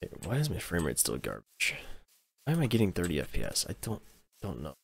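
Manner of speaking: usual speaking voice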